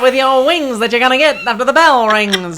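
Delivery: hammed-up 1940s Mid-Atlantic actor; old movie accent. Liz mocks old film actors with a Mid-Atlantic accent.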